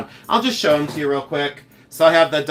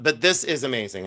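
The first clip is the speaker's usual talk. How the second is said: ordinary talk, nothing marked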